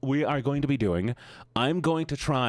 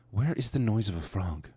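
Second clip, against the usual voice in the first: ordinary talk, nothing marked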